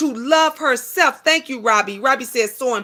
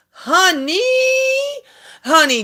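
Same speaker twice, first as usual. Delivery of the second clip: higher, sweet put-on honey; lovely teeth voice; 7 semitones higher than usual. Calling out to her viewers in her lovely teeth voice. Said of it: "in my lovely teeth voice".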